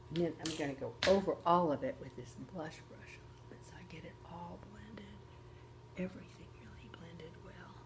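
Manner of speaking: soft unvoiced whisper while working; hushed whispering through the blending. Real, not acted. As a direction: breathe the words out, ASMR-like.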